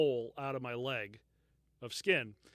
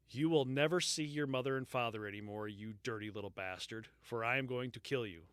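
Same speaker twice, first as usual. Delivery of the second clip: quoted threat; 4 semitones lower, 7 dB quieter than usual. Retold or imitated. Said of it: "Who the fuck are you?".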